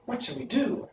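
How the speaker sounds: high, soft despairing plea for help; a small girl's despairing question at the edge of a ditch. A narrator's reading. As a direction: ask it as if all hope is gone. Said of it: "asked Dorothy despairingly".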